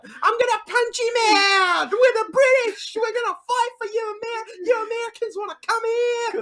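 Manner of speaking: high British tough-guy caricature; hammed-up combative rant, starting loud; mock wrestling promo. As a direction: put on a British brawler's voice. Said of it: "He was certainly cutting the promo".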